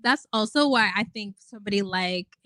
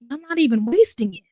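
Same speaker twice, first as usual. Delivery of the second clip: louder, higher, exasperated complaint; exasperated outburst; 4 semitones higher, 5 dB louder than usual. Real, not acted. Vlogger to her viewers, an exasperated loud outburst. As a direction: loudly vent the frustration. Venting about wasting youth.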